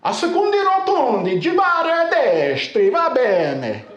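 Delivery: high, slow mock Italian GPS voice; GPS lady in Italian. Quoting the car's GPS in Italian, high and slow. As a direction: put on the Italian sat-nav lady's lilt. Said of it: "they say things like".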